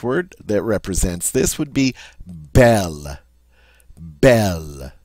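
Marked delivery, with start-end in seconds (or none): 2.3-3.2 "belle" with the short E of net. Shown as a demonstration. Teacher gives French example word, short E sound. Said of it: "It's a short E sound". 4.0-4.9 "Belle" lower, with the E of net; short E. Shown as a demonstration. Teacher repeats French example word, short E sound. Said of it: "Hear that short E sound?".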